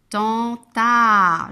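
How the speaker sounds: "tenta" loud, each syllable drawn out; syllable sounding-out. Shown as a demonstration. Teacher sounding out a word for children, "tenta" loud and stretched. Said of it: "j'entends dans la syllabe ta".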